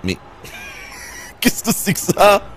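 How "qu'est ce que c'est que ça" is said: stunned. Real, not acted. Shocked at weird scene.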